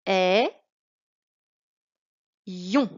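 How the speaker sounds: "Ay-" loud, pause, then "yon"; split into syllables. Shown as a demonstration. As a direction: break "Ay-" from "yon" with a clear pause. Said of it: "Ce qu'il y a en bleu".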